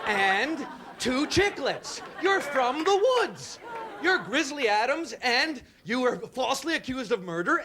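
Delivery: slow, high, incredulous and mocking; indignant rant. To the stingy gum guy, a slow high indignant rant. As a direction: scold him for one chiclet.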